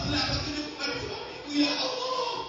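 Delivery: pleading prayer. Retold or imitated. Wife praying at night for her husband, pleading. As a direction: implore God loudly, drawing out the appeal. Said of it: "Dia berdoa".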